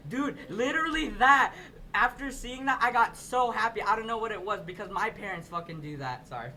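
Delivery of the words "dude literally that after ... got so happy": loud, high and thrilled; real excitement, louder and higher; excited. Real, not acted. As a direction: burst out with joy at the memory. Excited about Filipino representation.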